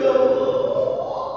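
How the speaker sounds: high, drawn-out wail of Ya Allah; pained moan. Shown as a demonstration. Someone with toothache crying out, a high drawn-out moan. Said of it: "Ngomongnya gimana?".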